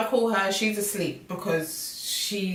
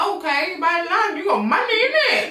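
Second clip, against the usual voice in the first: high, giggling, teasing; laughing mock reply; 8 semitones higher than usual. Friend teasing about buying virtual land, high giggle. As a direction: laugh through the words. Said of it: "I'm like".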